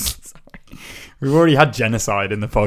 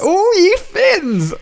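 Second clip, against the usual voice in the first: mock scolding; 4 dB louder, much slower than usual. Mock scolding the Finnish voters.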